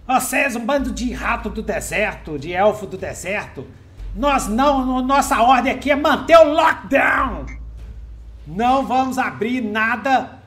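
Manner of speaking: high, loud barked orders; angry captain shouting. Acted.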